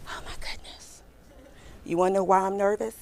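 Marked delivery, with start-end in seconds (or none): 0.1-1.0 breathless whispered oh my goodness; whispered panic. Retold or imitated. Recruiter retelling her panic, hushed whisper. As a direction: whisper it so the interviewer can't hear.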